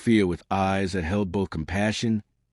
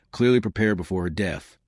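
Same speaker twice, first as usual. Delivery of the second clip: usual speaking voice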